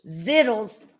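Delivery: "Zittles" with a clear Z, like Skittles; correcting pronunciation. Shown as a demonstration. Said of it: "Like Skittles".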